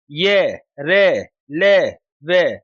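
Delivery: ये, रे, ले, वे each stretched out slowly and firmly; slow sound demo. Shown as a demonstration. Teacher sounding out ये, रे, ले, वे for students, slow and deliberate. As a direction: sound out ये, रे, ले, वे syllable by syllable. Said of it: "वर्ण अंत्यस्थ व्यंजन के अंतर्गत आते हैं".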